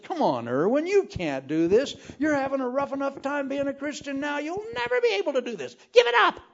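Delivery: high, rising taunting voice that climbs to a shriek; tempting inner doubt. Retold or imitated. Doubt taunting the preacher, climbing to a high loud shriek. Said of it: "it rose up and said".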